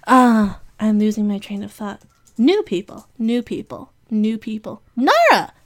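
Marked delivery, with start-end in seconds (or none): 5.0-5.5 high excited call of the name. Real, not acted. Speaker suddenly remembering a friend, excited call. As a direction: sing out the name with delight.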